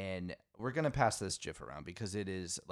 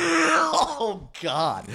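high, loud shriek of disbelief; real laughing outburst; 11 dB louder than usual. Real, not acted. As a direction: burst out loud and high at a pun you missed. Bursts out at unnoticed pun.

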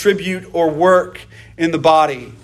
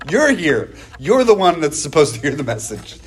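normal talking voice

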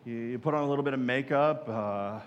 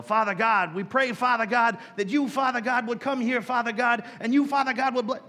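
mocking churchy prayer voice; mock repetitive prayer; 6 semitones higher than usual. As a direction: pile up Father God like an overzealous pray-er. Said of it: "Father God, over and over again".

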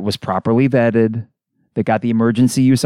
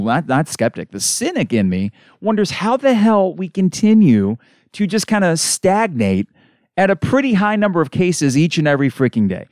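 ordinary talk, nothing marked

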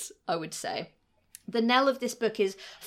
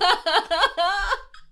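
laughing; 11 semitones higher, 7 dB louder than usual. Real, not acted.

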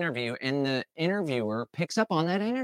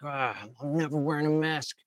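mock pissed-off defiance; 3 semitones higher than usual. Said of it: "you're all pissed off".

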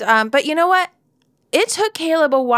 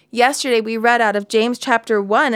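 usual speaking voice

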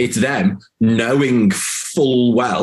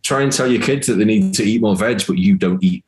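ordinary talk, nothing marked

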